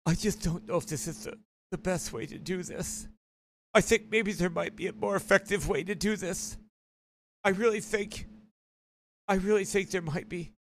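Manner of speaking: soft, hesitant mock meekness; imitated timid objector. Critic doubting the method to younger men, mock meek. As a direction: mimic a hushed, unsure doubter with halting pauses. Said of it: "he goes like this".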